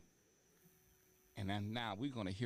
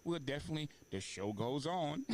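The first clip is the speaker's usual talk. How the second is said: excited; 6 semitones higher, 5 dB louder than usual. Real, not acted. Host cheers the show on.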